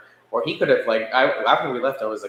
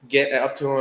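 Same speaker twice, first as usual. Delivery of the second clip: normal talking voice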